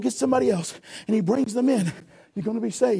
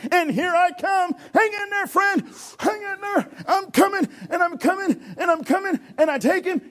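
excited rescue; 7 semitones higher than usual. Acted. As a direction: shout encouragement across the waves. Lifeguard swimming to rescue.

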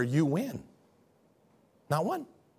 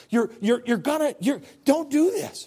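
higher, stammering, mounting plea; desperate pleading; 7 semitones higher than usual. Acted. Loving pastor running out of words, stammering high-pitched desperate plea. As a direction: beg harder, stretching the final words. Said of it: "comes to you with tears in his eyes and says".

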